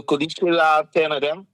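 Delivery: usual speaking voice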